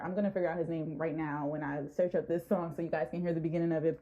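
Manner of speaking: rushing the words out; racing through it, excited; fast excited talk. Real, not acted. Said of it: "I talk really fast when I get excited".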